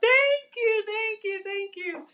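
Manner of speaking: bright little thank-yous dying away; high sing-song thanks. Real, not acted.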